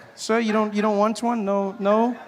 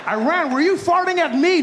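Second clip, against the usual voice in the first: accusing shout; 9 semitones higher, 5 dB louder than usual. Retold or imitated. America confronts Iran.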